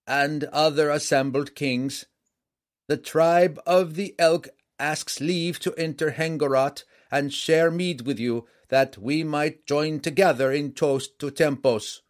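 loud, high, ceremonial address; formal herald. A narrator's reading. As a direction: proclaim it loudly to assembled kings. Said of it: "the standard-bearer began".

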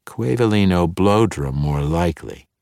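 plain reading voice

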